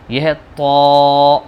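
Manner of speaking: slow, loud, heavy طو; slow full-mouthed letter. Shown as a demonstration. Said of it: "اس کو ہم منہ بھر کے پڑھیں گے".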